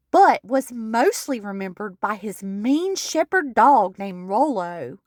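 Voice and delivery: normal talking voice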